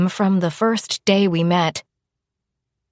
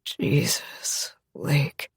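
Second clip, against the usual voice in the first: breathy moan; 2 semitones lower, 6 dB quieter, much slower than usual. Acted. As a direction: let the words fall out on a breath. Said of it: "he moaned".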